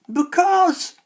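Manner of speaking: very high, loud witch's shriek. A narrator's reading. Old hags shrieking off the boy's question. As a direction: shriek the word like a crone. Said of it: "shrieked the old hags".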